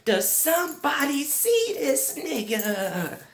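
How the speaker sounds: high, slow drawn-out incredulous question; stunned disbelief. Retold or imitated. Disbelief during bowed heads.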